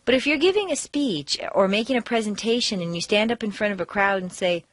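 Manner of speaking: ordinary talk, nothing marked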